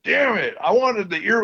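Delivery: high, whiny mock complaint; mock frustration. Griping about the missing band footage, mock annoyed. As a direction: gripe like a cheated fan. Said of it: "I was so mad for years".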